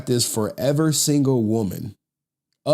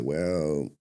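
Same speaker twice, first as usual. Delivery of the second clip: imitating Snow Bunny heaven's reply; 5 semitones lower, 5 dB quieter than usual. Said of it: "It was like".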